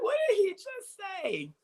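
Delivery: very high, stunned disbelief; astonished again. Retold or imitated. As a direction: squeak it out in disbelief. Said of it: "I went".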